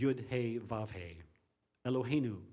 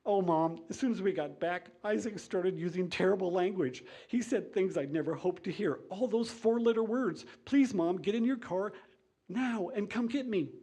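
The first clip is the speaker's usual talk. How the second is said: high, loud, hammed-up tearful plea; hammed-up tearful daughter pleading; mock crying; 7 semitones higher, 3 dB louder than usual. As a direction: whine it like a weepy newlywed begging mom. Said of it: "Sarah starts to cry".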